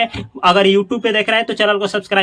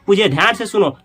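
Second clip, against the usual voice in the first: normal talking voice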